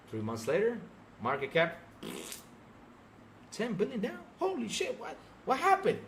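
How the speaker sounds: ordinary delivery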